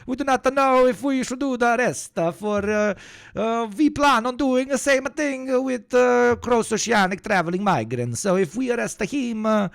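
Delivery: put-on over-the-top Italian accent; mock Italian accent. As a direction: play a cartoon Italian with a thick Italian accent. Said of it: "this anti-Italian accent".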